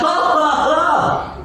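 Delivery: high, slow hammed-up "ha, ha, ha" cackle; mocking laugh. Preacher acting out Sarah laughing at God's promise, high mock laugh. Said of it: "She burst out".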